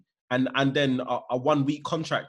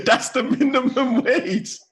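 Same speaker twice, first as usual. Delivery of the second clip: higher, louder laughing line; 9 semitones higher, 6 dB louder, slower than usual. Real, not acted. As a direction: laugh through the words. Laughing while speaking.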